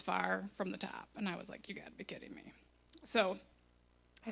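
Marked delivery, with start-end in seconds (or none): 1.7-2.5 much softer, under the breath; retold aside, barely voiced; muttered disbelief. Customer seeing the drink far from the top, muttered disbelief.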